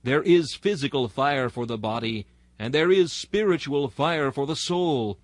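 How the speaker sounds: ordinary delivery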